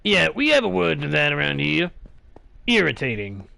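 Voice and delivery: low gravelly put-on voice. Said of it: "that gravelly voice attempt".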